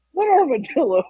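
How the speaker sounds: high-pitched mock bewilderment; incredulous question, acted out; baffled dad, imitated. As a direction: imitate a dad utterly confused.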